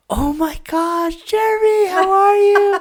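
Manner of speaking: high, drawn-out squeal of greeting; big, loud, high-pitched hello; excited coworker. Retold or imitated. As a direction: gush like a thrilled coworker spotting a friend. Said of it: "what are you excited about".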